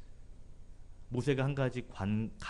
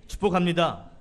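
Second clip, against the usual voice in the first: louder repeat; 4 semitones higher, 6 dB louder than usual. Real, not acted.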